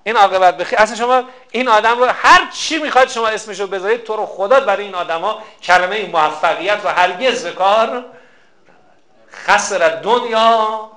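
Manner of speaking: loud, impassioned sermon voice; fiery preaching. Acted. Preacher condemning children who leave mothers in care homes, loud and fervent. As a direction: rail against the neglect of parents.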